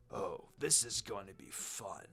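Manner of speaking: low, soft menacing villain voice; a low, quiet amused killer relishing the fight; musing villain. Acted. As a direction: savour the coming fight, quietly. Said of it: "Zabuza mused".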